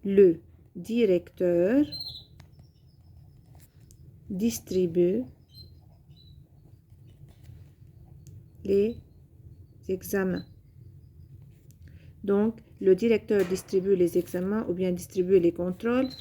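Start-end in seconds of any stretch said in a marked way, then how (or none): 0.0-10.4 slow, word by word with long pauses; dictation pace. Shown as a demonstration. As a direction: spell the sentence out word by word for pupils. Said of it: "Par exemple".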